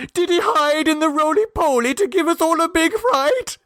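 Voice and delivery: put-on high baby-talk coo; cooing mother. A narrator's reading. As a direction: coo it like a doting mother scolding gently. Mother coos to found child.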